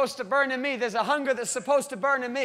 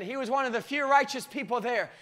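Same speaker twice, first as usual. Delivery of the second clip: normal talking voice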